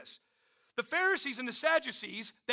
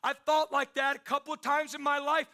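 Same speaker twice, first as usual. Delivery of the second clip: normal talking voice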